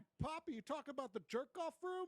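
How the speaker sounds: high, excited spoiled son; spoiled kid voice. Pretend. Son asking his father about the secret room, high squeal. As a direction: squeal it like a rich brat.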